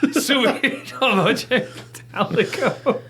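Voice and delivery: laughing mock Italian. Mock Italian musical phrase.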